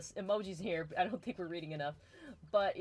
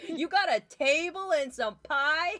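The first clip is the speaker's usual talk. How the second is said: eager guy voice; 9 semitones higher, 9 dB louder than usual. Retold or imitated. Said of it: "He was just like".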